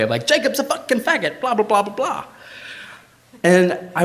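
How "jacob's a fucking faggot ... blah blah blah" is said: high, hammed-up mock proclamation; bombastic announcement. [name] standing on the table announces it to the party, bombastic mock proclamation. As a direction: announce it grandly from the coffee table. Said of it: "in this very, like, bombastic way".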